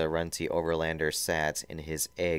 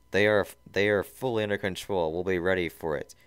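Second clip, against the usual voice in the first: ordinary delivery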